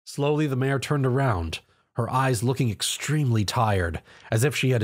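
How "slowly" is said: ordinary delivery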